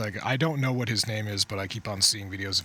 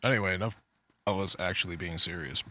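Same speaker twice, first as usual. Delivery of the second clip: ordinary talk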